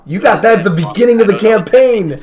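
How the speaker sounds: high, loud burst of amazement; excited disbelief. Real, not acted. Player amazed at an item from early on, excited disbelief. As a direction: exclaim it loudly, pitched right up.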